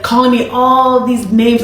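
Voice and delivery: ordinary delivery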